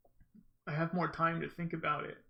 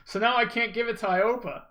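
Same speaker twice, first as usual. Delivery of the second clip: loud exasperated outburst, pitched up; raging gamer; 6 semitones higher, 9 dB louder than usual. Real, not acted. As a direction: blurt it out, half laughing in frustration. Realizes he must keep controller.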